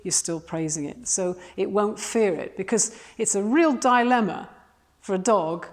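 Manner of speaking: ordinary delivery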